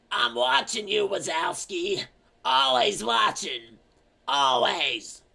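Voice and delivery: high, slow, raspy-free imitation of the Slug Lady. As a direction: do the creepy office slug lady, drawn out. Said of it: "she's like".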